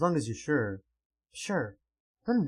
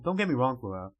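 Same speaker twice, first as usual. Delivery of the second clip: normal talking voice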